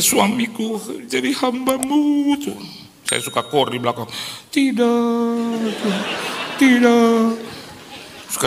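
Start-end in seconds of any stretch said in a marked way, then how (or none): 0.0-2.3 lightly voiced tearful bride. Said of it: "nangis terus". 4.5-7.4 mock protest. Husband protests in choir.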